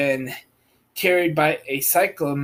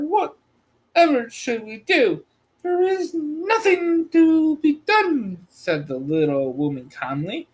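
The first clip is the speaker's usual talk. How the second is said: raised, lightly voiced figures; girl and old woman voices; 11 semitones higher than usual. Dialogue of Dorothy and woman.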